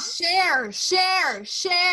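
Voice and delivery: high, loud repeated call; hammed-up, excited urging to share; playful shouted plea. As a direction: belt it out like a chant. Calling out the sign-off plea.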